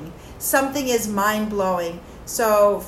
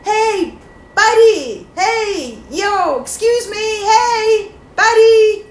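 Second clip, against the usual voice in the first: high, loud call across a distance; calling out loudly; 10 semitones higher, 6 dB louder, slower than usual. Shown as a demonstration. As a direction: shout to get a stranger's attention. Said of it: "You have to call out and say".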